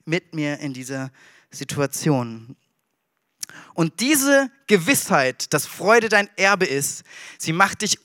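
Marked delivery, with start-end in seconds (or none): 0.0-2.5 soft, low, slowing prayer; whisper-close reassurance to yourself; comforting prayer for the lonely listener; quiet trusting prayer. Acted. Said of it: "dann kannst du sagen".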